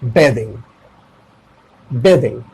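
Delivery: "बेथिंग" said with "ay", bay-thing; "बेथिंग" as bay-thing, not ba-thing; correct "bathing". Shown as a demonstration. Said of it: "ये क्या हो जाएगा?".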